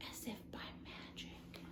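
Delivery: hushed, breathy whisper. A narrator's reading. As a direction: whisper it like a secret spell. Narrator hushes the magic.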